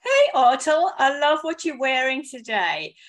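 ordinary talk

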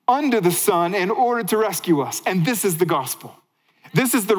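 rising preacher's fervor; preaching at full force. Acted. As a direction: drive it home from the pulpit. Preaching climax.

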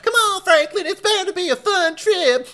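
very high, loud coaxing; impression of Sally. Host mocking how she coaxes Franklin along, high impression. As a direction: mimic a woman in a loud high pitch. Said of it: "he's doing an impression of her".

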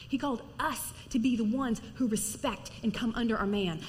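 usual speaking voice

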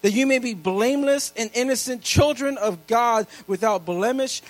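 loud, high preacher's reading of scripture; preaching scripture. Acted. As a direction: proclaim every verse to the whole church. Reads scripture at full preaching force.

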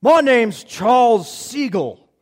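loud, slow, high, exaggerated put-on accent; Charles Siegel. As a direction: announce yourself grandly in a butchered accent. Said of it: "got this incredible accent".

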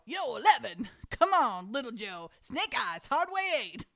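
hammed-up dice-table calls, jumping up in pitch; craps player's calls. Mother acting out back-room craps to her daughter, hammed-up calls. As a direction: call the dice like an excited gambler.

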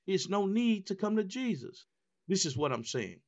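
high, rising preacher's delivery; impassioned preaching. Real, not acted. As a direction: preach it hard, pitched high, phrase by phrase.

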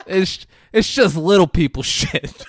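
loud, high, through laughter; amused imitation. Retelling a friend explaining it away, laughing imitation. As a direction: crack up while voicing the line. Acts dismissive friend, cracking up.

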